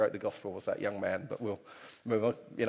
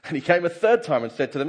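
ordinary talk, nothing marked

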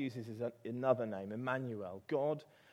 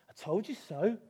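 mouse voice pitched higher, a little louder; smug mouse; 9 semitones higher, 6 dB louder than usual. Acted. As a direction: tell him so like a know-it-all little mouse. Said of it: "said the mouse".

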